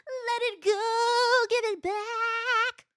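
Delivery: high, drawn-out, sing-song plea; mock wail. Player begging old man to return her cue, mock wail. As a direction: stretch each word out in a high, whiny-comic cry.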